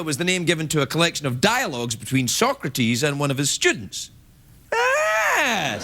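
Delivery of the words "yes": mock triumphant shout. Mock cheer over the crowd.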